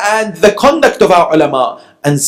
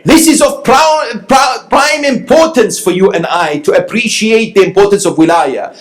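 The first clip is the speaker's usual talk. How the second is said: fervent preaching; 8 semitones higher than usual. Acted. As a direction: drive the point home from the pulpit. Preacher building to fervent climax.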